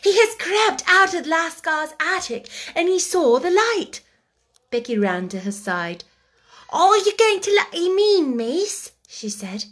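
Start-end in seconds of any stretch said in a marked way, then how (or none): 0.0-4.0 high and loud with delight; excited. Acted. Sarah telling Becky the monkey crept out, high and excited. 6.7-8.9 put-on high, loud servant-girl voice; anxious maid. Becky asking Sarah about the monkey, high and loud.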